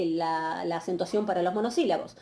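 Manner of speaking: usual speaking voice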